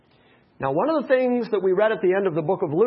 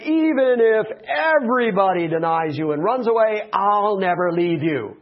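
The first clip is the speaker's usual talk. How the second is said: mock-boastful vow, raised and emphatic; uppity boasting; 3 semitones higher, 4 dB louder than usual. Peter bragging to Jesus at the Last Supper, uppity. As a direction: puff it up, full of himself. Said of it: "when Peter got all uppity, when Peter got all full of himself".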